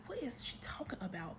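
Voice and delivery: hushed whisper of disbelief; whispered aside. Retold or imitated. Narrator whispering her own shocked reaction to the viewers. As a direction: whisper it like a private thought.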